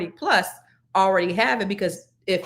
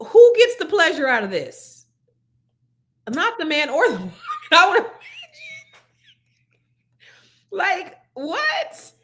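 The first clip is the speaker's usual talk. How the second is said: incredulous laughing disbelief; 9 semitones higher, 3 dB louder than usual. Real, not acted. Incredulous at dental dams.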